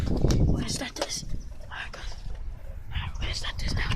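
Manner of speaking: whispering. Real, not acted. Hiding, whispering to camera.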